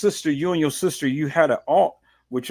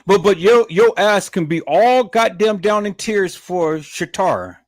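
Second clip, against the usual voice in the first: higher and louder, furious outburst; angry shout; 7 semitones higher, 5 dB louder than usual. Real, not acted. Reactor yelling at the mother over her tears. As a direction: blow up at her in disbelief.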